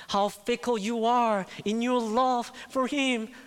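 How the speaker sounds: high, loud preaching at full force; crying out. Real, not acted. Preacher urging church to admit fickle faith, crying out. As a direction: cry it out fervently, stretching the plea. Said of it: "That's why I'm crying out".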